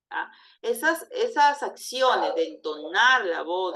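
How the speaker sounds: normal talking voice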